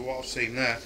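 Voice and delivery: ordinary talk, nothing marked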